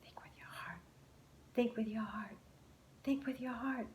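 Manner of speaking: soft whispered mantra; a hushed repeated whisper. A narrator's reading. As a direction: whisper it to yourself, three times. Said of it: "he whispered three times".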